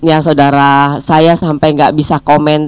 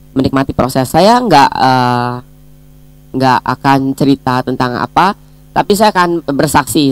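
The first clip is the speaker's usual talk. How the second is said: ordinary delivery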